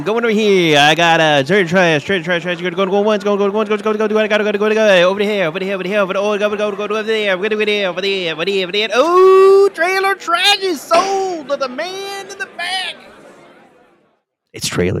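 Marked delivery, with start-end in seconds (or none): 0.0-8.9 high, loud frantic play-by-play; hyped game commentary. Acted. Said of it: "he committed to it". 8.9-13.0 high, loud slow auctioneer call; calling from far away. Acted. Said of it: "he committed to it".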